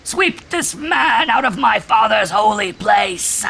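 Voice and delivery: slow, very high, loud screaming; screaming command. A narrator's reading. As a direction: scream it as a frenzied invocation. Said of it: "he screamed".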